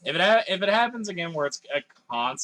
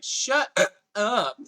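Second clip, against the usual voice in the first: mock annoyed girlfriend; 7 semitones higher, 5 dB louder than usual. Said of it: "she's like".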